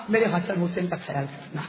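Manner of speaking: low, soft dying whisper-like plea; mother entrusting her sons, softly; hushed plea. Acted. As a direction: let it sink to a tender murmur. Dying mother's last request, repeated.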